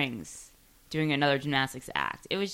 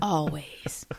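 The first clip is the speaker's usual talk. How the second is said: whisper; 2 semitones lower, 5 dB quieter than usual. Pretend. Conspiratorial aside after racist joke.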